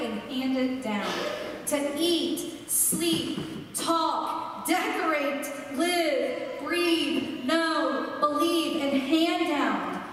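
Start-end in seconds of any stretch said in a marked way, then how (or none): 1.7-10.0 rising preacher's litany; lightly raised preaching cadence. Acted. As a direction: build the list with fire.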